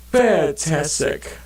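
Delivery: slow ironic cheer; sarcastic fantastic. Real, not acted. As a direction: drag it out with mock delight. Reacting to getting a backpack.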